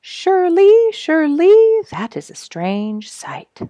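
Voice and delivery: high, loud gasp of wonder, then slowing; astonished high bluebird voice; amazed bluebird. Acted. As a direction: marvel at the strange sight as a bird. Bluebird sees ground rise.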